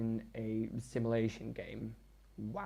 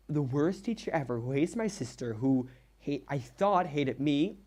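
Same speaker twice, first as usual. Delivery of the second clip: ordinary delivery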